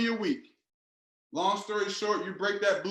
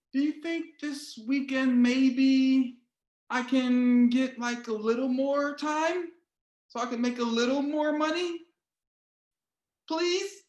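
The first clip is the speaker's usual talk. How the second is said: high, slow, pleading ask to the boss; meek worker begging for overtime; timid begging employee voice; 5 semitones higher, slower than usual. Retold or imitated. Worker timidly begs the boss for more hours, high and pleading. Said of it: "The voice is dope".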